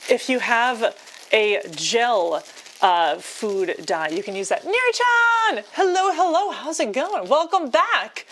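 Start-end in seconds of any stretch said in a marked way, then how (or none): 4.6-8.3 excited greeting. Real, not acted. Welcoming a returning chat friend, a high excited call. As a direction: sing out the name in delight.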